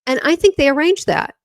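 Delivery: ordinary talk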